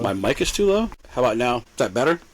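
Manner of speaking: loud, high mic check. Real, not acted. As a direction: speak up for a level test. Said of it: "your mic's a little low".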